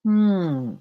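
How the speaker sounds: ordinary delivery, nothing marked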